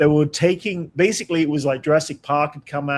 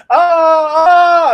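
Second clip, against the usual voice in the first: loud, much higher hammed-up shouts of pain; overacted agony cries for a scared audience; 13 semitones higher, 10 dB louder than usual. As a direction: ham up the agony for the kids. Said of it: "completely overact going".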